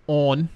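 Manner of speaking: "on" with a rounded, drawn-out Baltimore O; Baltimore-accented "on"; weird Baltimore O. Shown as a demonstration. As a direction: stretch the vowel in "on", Baltimore style. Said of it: "they say their O is really weird".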